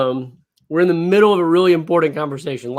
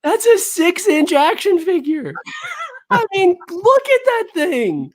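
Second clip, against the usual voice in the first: real amazement; 10 semitones higher than usual. Real, not acted. Friend pointing at the giant box beside a figure, astonished. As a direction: pitch it way up and stretch it out. Marvels at photo of huge box.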